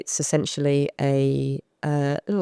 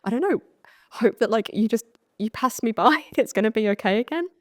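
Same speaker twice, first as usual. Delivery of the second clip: high, small, laughing plea; hopeful freeze; 7 semitones higher than usual. Retold or imitated. Frozen with a man, a high nervous plea. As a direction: say it like hiding and hoping to be passed by. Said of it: "I'd be like".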